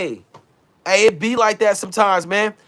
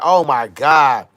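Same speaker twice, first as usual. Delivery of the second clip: lower, slow, mocking gasp of gossip; 7 semitones lower, 4 dB louder, slower than usual. Mocking a gossiping viewer in the car, slow mock gasp. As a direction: drag it out like a fake gossiper. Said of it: "You gossip in your car acting like".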